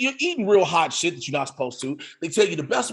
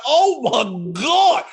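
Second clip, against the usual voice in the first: slow strained "oh my God"; mock pained moan; drawn-out burning groan; 5 semitones higher, much slower than usual. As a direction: stretch it out like your mouth's on fire. Mouth burning from sauce.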